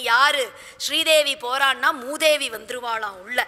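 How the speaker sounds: ordinary talk, nothing marked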